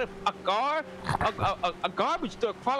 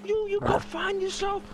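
mock dreamy; 9 semitones higher than usual.

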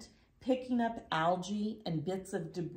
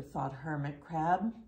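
usual speaking voice

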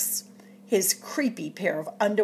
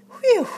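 relieved whew; 7 dB louder than usual. Acted. Relief after searching for underwear.

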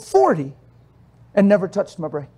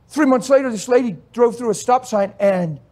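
ordinary talk, nothing marked

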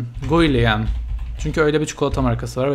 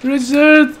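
much higher and louder cry; shocked yell; 12 semitones higher, 9 dB louder, slower than usual. Real, not acted. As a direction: wail in disbelief. Sim leaves, player yells. (çok tiz ve yüksek, inanamayan çığlık)

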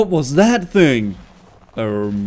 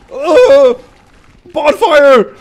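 frustrated yell; 8 semitones higher, 10 dB louder, much slower than usual. Real, not acted.

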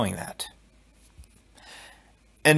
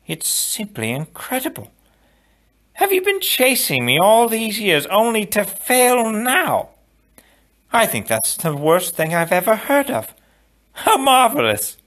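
mocking count; 11 semitones higher than usual. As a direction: gloat over the failed son. Count Rugen mocking Inigo.

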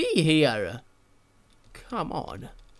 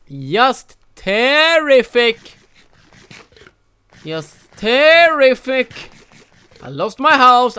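sarcastic; 6 semitones higher, 7 dB louder, slower than usual. Real, not acted. Sarcastic about bad luck.

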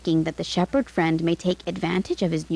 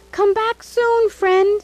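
high, louder call after a departing friend; 10 semitones higher, 4 dB louder than usual. A narrator's reading. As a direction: call out across the distance, eager. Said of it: "the shepherd called out".

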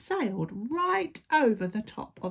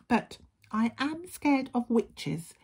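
higher little-wolf voice; nervous little wolf; 6 semitones higher than usual. A narrator's reading. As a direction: confess it timidly as the small wolf. Little wolf admits a fear.

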